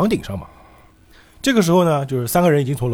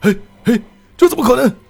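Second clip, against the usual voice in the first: high-pitched villain's protest; panicked disbelief; 11 semitones higher, 4 dB louder than usual. Acted. Villain's invincible trick fails, panicked disbelief. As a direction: squawk in loud, high-pitched dismay.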